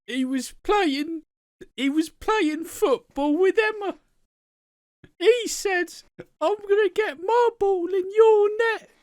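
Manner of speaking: hammed-up little boy telling tales; sugar-rush child. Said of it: "he'd had too much sugar".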